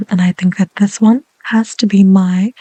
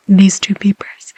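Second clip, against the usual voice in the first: whisper. Real, not acted. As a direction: breathe the words gently. ASMR whisper.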